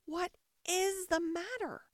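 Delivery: high, drawn-out question; making herself heard. A narrator's reading. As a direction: call out slowly over the din. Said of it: "as soon as there was a chance of making herself heard".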